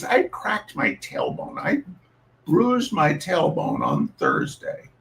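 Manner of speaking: plain reading voice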